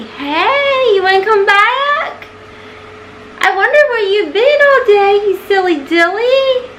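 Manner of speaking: high sing-song pet voice; cooing to the cat to come back. Real, not acted. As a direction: coo up high at the kitty. Talking to the cat.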